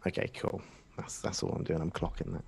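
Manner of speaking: muttered aside. Real, not acted. Player aside, barely voiced.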